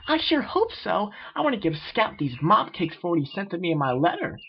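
high, bouncy eager voice; bubbly Pinkie. Acted. Pinkie tells friends about cakes for Scout, high and eager. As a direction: gush like an excited party pony.

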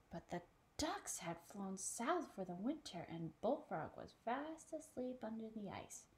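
ordinary delivery, nothing marked

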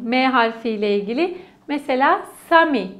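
ordinary talk

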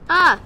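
sharp high yelp; quick surprised cry; startled yelp. Real, not acted. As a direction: yelp at the sudden scare. Sudden yell in game.

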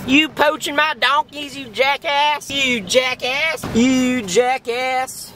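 hammed-up southern yokel, high-pitched hollering; southern accent. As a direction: play an outraged southern farmer calling Ma. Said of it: "everybody has a southern accent".

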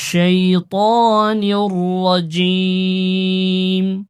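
slow melodic Quranic recitation. Shown as a demonstration. Teacher reciting to students, slow melodic chant. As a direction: draw each word out in tajweed chant.

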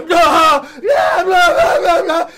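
high, loud startled yelling; frightened yell. Retold or imitated. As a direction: yell back in shock. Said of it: "My scream go through the air".

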